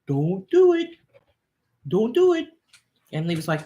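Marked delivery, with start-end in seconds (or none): 0.1-2.5 high, urgent warning voice of her own head; a much higher voice pleading with her to stop; inner voice warning. Retold or imitated. Her head warns her not to speak up, high and urgent. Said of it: "my head's like".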